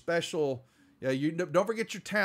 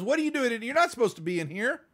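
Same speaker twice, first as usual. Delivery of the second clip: higher, mock-yelled rebuke; mock angry yell; 8 semitones higher, 3 dB louder than usual. Deputy scolds the intruders, mock yelling higher. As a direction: chase them out like a bossy deputy. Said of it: "the deputy goes".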